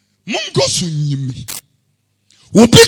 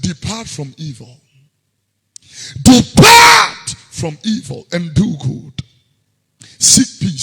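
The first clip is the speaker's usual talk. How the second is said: impassioned preaching; 6 dB louder than usual. Acted. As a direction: plead the command. Crying out the verse.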